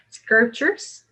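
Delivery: Sculptures slowly, lifted at the end; rising intonation. Shown as a demonstration. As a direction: raise the ending of Sculptures as a list item. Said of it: "올라가고요". (Sculptures 끝 억양을 올려서)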